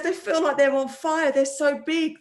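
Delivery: hammed-up tearful kid complaining about his tonsils; mock crying son. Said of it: "because he was crying".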